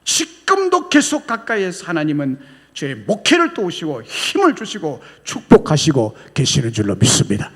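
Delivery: usual speaking voice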